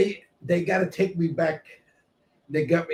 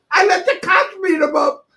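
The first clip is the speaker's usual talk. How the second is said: normal talking voice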